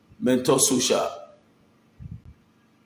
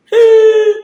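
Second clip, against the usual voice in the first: excited yell; 13 dB louder than usual. Real, not acted. Excited hail of a fan.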